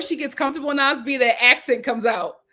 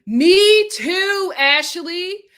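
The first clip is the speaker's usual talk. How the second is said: excited; 8 semitones higher, 9 dB louder, much slower than usual. Real, not acted. Excited agreement with co-host.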